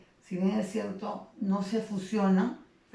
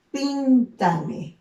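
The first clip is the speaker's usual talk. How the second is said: "píntame" loud and higher, weight on pín; stress on PIN; 4 semitones higher, 7 dB louder than usual. Shown as a demonstration. Teacher showing students the stressed syllable, PÍN hit hard in "píntame". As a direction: punch the first syllable of "píntame". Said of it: "dónde está la mayor énfa".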